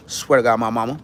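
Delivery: lightly voiced low uncle swearing an oath; a hint of a low-voiced uncle; Big Unc. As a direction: drop the pitch into a low oath. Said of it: "like Big Unc".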